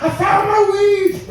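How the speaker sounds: very high, drawn-out squeal of joy; elated yell. Acted. Waking girlfriend to share the find, elated. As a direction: stretch it out in high-pitched triumph.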